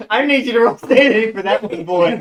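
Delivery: loud, high, excited game-master call; gleeful loud demand. Real, not acted. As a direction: belt it out with relish.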